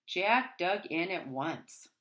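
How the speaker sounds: ordinary delivery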